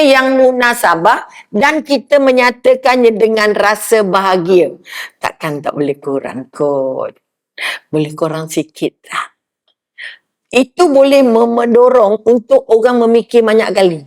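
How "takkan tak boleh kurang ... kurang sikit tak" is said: soft bargaining plea. Acted. Preacher acts out bargaining customer.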